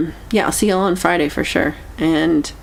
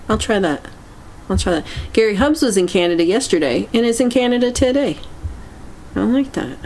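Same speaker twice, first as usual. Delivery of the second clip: ordinary talk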